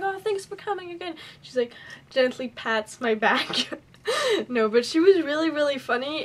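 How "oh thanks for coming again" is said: high, softened quoted voice; imitated actress's thanks. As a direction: lightly act out her sweet thank-you up high.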